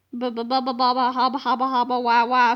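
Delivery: put-on low, dazed baby babble; babbling nonsense. A narrator's reading. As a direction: stammer helplessly like a stunned man.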